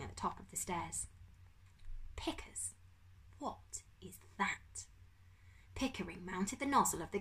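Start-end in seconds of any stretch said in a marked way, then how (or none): 2.2-4.9 slow, stunned whisper; hushed dread. Acted. Humphrey seeing the beetle boulder, whispering slowly.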